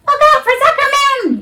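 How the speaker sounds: very high yelling gander. A narrator's reading. Gander yelling a warning to Wilbur. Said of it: "yelled the gander".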